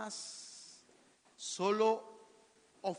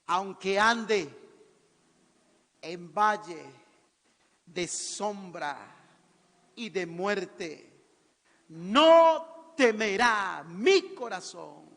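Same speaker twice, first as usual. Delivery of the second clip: slow, weighty scripture proclamation; measured psalm recital building to a loud peak; preaching declamation; 7 dB louder, much slower than usual. Real, not acted. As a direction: declaim each phrase apart, swelling loud at the end.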